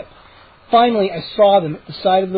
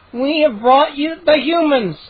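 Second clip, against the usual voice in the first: higher, slow, gleeful gnome voice; gleeful gnome announcing; 7 semitones higher than usual. Acted. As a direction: announce the prize like a giddy gnome. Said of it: "Hap announced, grinning happily".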